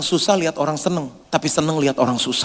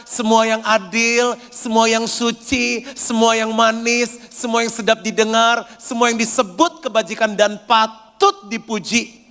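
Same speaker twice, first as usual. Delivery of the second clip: slow, emphatic reading of each line; solemn scripture reading; 7 semitones higher, slower than usual. Acted. Preacher reads Philippians four to the church, slow and solemn. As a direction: intone every semua as a proclamation.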